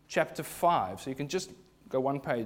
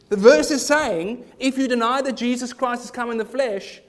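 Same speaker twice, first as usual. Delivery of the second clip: higher and louder, driving each clause home; full-force preaching; 7 semitones higher, 7 dB louder than usual. Real, not acted. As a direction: preach it hard to the congregation.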